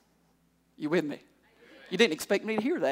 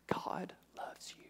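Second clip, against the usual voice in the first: whisper; 21 dB quieter, slower than usual. Real, not acted. Tender aside after sermon climax.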